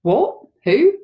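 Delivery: father's alarmed phone voice; voice gone funny. A narrator's reading. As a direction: blurt it in a funny, thrown voice. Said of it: "his voice goes all funny".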